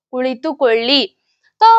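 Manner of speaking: normal talking voice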